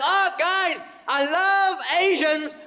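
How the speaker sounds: high, loud, slow shouted rant; hammed-up bigot rant. Comic mocking what he can't say on stage, shouted. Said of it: "I can't come out here and be like".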